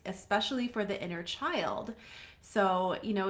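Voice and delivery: ordinary talk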